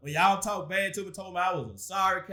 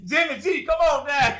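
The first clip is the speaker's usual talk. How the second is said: game show call; 7 semitones higher, 4 dB louder than usual. Pretend. Summoning rivals, game-show style.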